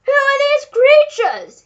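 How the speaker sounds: slow, very high, loud outrage; duke bellowing. A narrator's reading. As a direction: bellow it as if about to have a fit. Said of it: "he bellowed".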